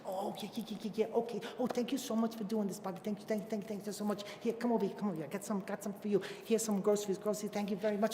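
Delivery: a lightly voiced grocer lady, quick and gushing; lightly played gushing shopkeeper. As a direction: rattle off the thanks like an eager shopkeeper. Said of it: "And she's like".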